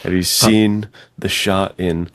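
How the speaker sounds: ordinary talk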